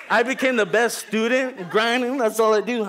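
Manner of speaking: loud, high, hyped boast. Real, not acted. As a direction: brag about "I became the best student". Hyped retelling of change.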